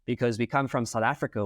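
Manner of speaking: ordinary delivery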